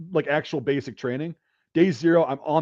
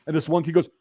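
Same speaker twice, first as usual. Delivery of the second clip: normal talking voice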